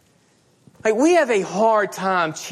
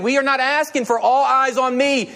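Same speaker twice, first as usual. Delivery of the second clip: ordinary talk, nothing marked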